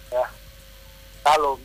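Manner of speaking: ordinary delivery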